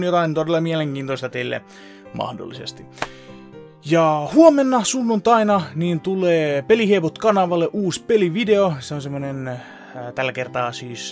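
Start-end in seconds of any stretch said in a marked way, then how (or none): none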